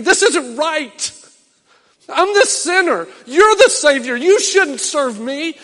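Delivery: high, loud protest, slowed down; protesting cry. Retold or imitated. Believer protesting to Christ, loud and high. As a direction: cry out that it isn't right. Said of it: "are we gonna scream".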